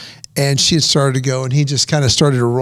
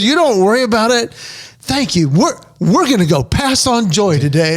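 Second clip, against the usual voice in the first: higher, lightly voiced smiling woman; smiling reassurance; 6 semitones higher than usual. A lady telling the driver not to worry, smiling and higher. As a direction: reassure him with a smile in the voice.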